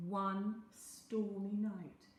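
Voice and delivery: ordinary delivery, nothing marked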